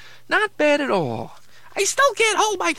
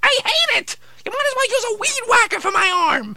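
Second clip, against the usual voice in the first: whiny protest; 6 semitones higher than usual. Acted. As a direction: protest the baseball bat arm. Puppet protests new arm.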